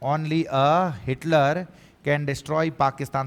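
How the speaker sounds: very slow, word by word, as if for notes; slow dictation. Shown as a demonstration. Teacher reading an exam sentence to students, very slow. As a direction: spell the exam sentence out slowly so students can write it. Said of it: "एक sentence exam में पूछा हुआ है".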